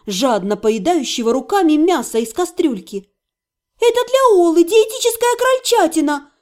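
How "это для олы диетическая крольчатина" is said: high indignant female voice; возмущённо, высоко и громко. A narrator's reading. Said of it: "возмутилась вошедшая Лира". (возмущённо)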